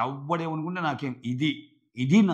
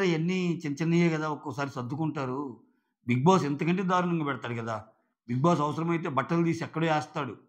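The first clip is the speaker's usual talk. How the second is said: usual speaking voice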